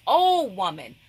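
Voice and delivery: emphatic, exclaimed OH; emphasis on OH. Shown as a demonstration. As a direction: hit OH hard, then fall away on WOMAN. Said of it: "That's emphasis".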